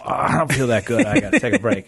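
mock sickly excuse, voice rising; feigning sickness. Host mocking a guest's sick excuse, light put-on. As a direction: pretend to feel unwell to dodge a caller. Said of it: "you can just go".

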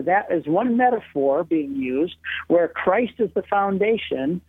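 usual speaking voice

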